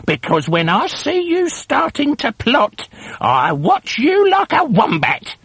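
put-on a shrill-pitched old wife nagging; hag wife. A narrator's reading. Wife needles her plotting husband, high hag voice. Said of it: "Missus Twit said".

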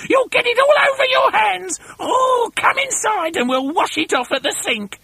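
high screechy scolding; fussing, shrieking wife; Missus Twit crying out. A narrator's reading. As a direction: scold him in a piercing high voice. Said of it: "cried Missus Twit".

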